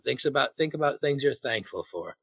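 normal talking voice

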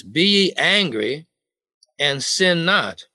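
slow, weighty verse reading; solemn Bible recitation; scripture reading. Acted. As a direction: read the verse slowly and deliberately.

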